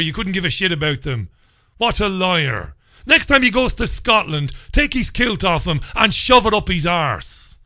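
ordinary delivery

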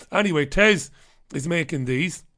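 normal talking voice

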